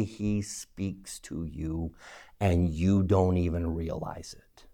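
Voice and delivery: quiet, intimate aside to the congregation; hushed. Shown as a demonstration. Key point said in a hush.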